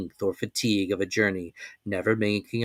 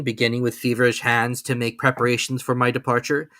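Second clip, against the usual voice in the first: ordinary delivery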